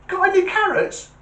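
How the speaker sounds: very high, squeaky little rabbit voice; joke rabbit. Acted. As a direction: pipe it up high as a cheeky bunny. Rabbit asks again in joke.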